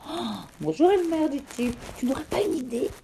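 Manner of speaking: bright high voice greeting his reflection; playful greeting. Acted. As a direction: chirp hello to your own reflection, teasing.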